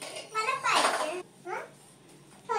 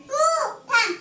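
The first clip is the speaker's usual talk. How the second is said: rising, louder, higher with each word; urgent calling out; 7 semitones higher, 5 dB louder than usual. Real, not acted. Child tells playmate to wait, urgent calling out. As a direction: insist they wait, ending high and loud.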